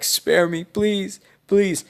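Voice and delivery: high-pitched begging for mercy; pleading. Acted. Bandit leader begging Naruto for his life, pleading.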